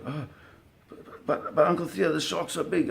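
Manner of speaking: put-on high, stammering scared boy voice; a boy pleading with his uncle, high and scared; scared kid. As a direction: imitate a frightened twelve-year-old pleading. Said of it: "Devin already getting afraid".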